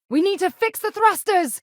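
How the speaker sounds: urgent alarm. Acted. Ship under attack alarm.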